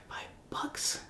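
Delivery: unvoiced, very soft puzzled whisper; bewildered whisper. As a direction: whisper it as if baffled by the word. Retelling her confused reaction as child.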